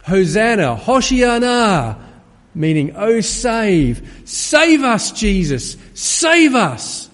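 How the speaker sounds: high, loud crying out to be saved; crowd calling out. Retold or imitated. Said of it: "And they called out".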